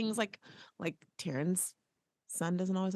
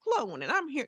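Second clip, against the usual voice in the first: mock clone voice; 8 semitones higher than usual. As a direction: play a stiff copy of yourself introducing itself. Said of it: "You can't be like".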